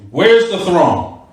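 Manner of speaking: slow, drawn-out "Where's the throne"; insistent repetition. Acted. Teacher acting out a debate with evasive opponents, slowly insistent. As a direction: press the question, stretching each word.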